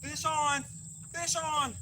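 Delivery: high excited shout of fish on; calling out a strike, thrilled. Real, not acted. Fish strikes, angler calls out.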